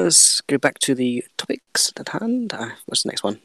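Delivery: whispered, slow aside. Real, not acted. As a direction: hush it, slowly, back to business.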